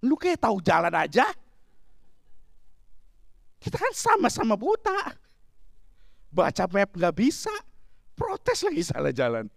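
high-pitched exasperated husband's comeback; acted-out bickering back at the wife; quarrelsome retort. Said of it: "kan akhirnya kita jadi berantem". (tiruan suami membalas dengan kesal)

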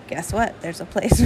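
ordinary talk

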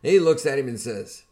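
ordinary delivery

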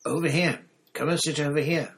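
high, loud, eager beckoning; urgent hissed call. A narrator's reading. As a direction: call someone over urgently. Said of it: "Ron hissed".

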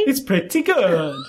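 bad Italian accent, slow; hammy Italian accent. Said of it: "In that worst Italian accent ever".

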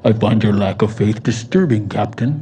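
very low, ominous villain voice; Darth Vader. Acted. Preacher mimics the film villain for laughs, deep voice. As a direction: drop far down into a menacing movie villain. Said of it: "That's the voice of Darth Vader".

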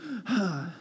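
soft, spent exhale; winded sigh. Retold or imitated. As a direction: mimic letting breath out after a long rant. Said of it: "you take a breath after you've been talking".

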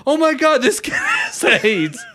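high-pitched, panicked shout slowing at the end; hammed-up horror, voice way up; acted freak-out. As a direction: imitate a kid freaking out. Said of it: "like, freak out".

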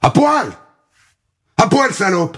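high shrill scream; screamed order. Acted. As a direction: scream it at her. (ordre hurlé)

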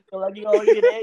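hysterical laugh. Real, not acted. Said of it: "nggak bakal bisa berhenti ketawa".

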